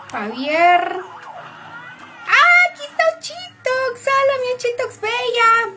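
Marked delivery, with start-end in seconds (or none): 2.3-5.7 high, loud, delighted hello; light up welcoming a friend; excited greeting. Real, not acted. Streamer greeting arriving viewer Ochitox, excited.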